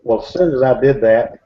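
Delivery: plain reading voice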